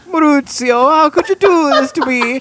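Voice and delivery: hammed-up high, tearful wail; Paolo. Mimicking a bald-wigged actor betrayed by family, melodramatic. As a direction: wail it slowly in mock heartbreak. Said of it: "by the bald wig and just like".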